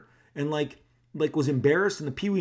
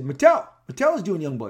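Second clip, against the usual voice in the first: "Mattel" higher and louder, then settling; proud answer; 3 semitones higher, 8 dB louder than usual. Retold or imitated. Answering Todd, announcing the toy maker with a loud high call. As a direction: blurt the name proudly. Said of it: "And I said".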